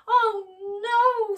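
high anguished cry. Acted. Scrooge seeing Tiny Tim's grave, a loud anguished cry. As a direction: wail it out in horror. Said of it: "Scrooge cried".

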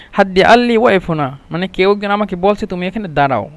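ordinary talk

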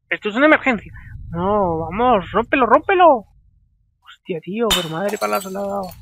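usual speaking voice

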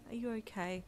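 soft, worried, slightly quivering; hushed relief with a slight tremble; quivering mumble. A narrator's reading. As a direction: ask gently, close to tears. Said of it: "he mumbled in a quivering voice".

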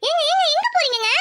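high-pitched, urgent call; calling after him. Acted. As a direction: call out to stop your husband leaving. Wife calls after departing husband. (பதறி உரக்கக் கூப்பிட்டு)

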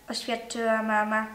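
usual speaking voice

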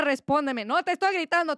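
high-pitched indignant protest; losing the calm act, pitched high; mock outburst. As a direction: protest you're not shouting as your pitch shoots up. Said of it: "Así hablo yo".

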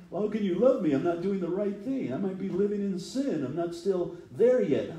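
high pleading believer voice; anxious believer. Retold or imitated. Worried believer voiced in sermon.

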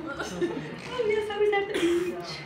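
high, airy casual reply; breezy high local voice. Retold or imitated. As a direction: toss it off lightly and high. Quoting tanned local reply.

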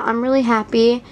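ordinary talk, nothing marked

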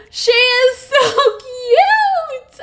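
high, loud, drawn-out gushing; thrilled squeal. Real, not acted. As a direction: squeal over a favourite figure. Thrilled to find Miss Piggy.

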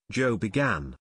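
usual speaking voice

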